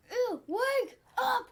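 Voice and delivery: high, slow drawn-out call; acted cry. Child acts out the man in the story, high drawn-out call. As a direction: stretch it out high, in a put-on voice.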